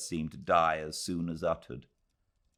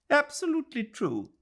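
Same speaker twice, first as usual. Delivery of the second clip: much higher put-on voice for a grand lady; hammed-up haughty lady; great lady's voice; faster than usual. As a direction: condescend grandly, like a burra memsahib. Mrs Turton voiced in novel.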